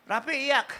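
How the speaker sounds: mock angry wife calling the children; scolding wife. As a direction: nag at him, then order the kids to cry. Wife orders kids to cry.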